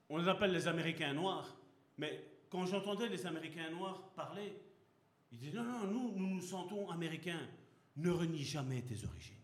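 ordinary delivery

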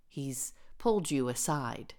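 ordinary talk

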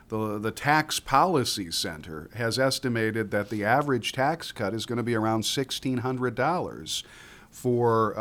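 ordinary delivery

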